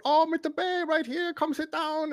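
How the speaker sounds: put-on very high welcoming nurse in Vietnamese accent; mock Vietnamese accent. Patient imitating the nurse calling him over in accent. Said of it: "doing the accent".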